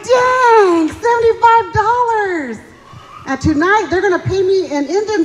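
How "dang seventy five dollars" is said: high, loud, thrilled exclamation; amazed shout. Retold or imitated. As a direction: shout it like you can't believe your luck. Amazed at winning money.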